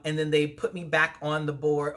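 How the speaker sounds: ordinary talk, nothing marked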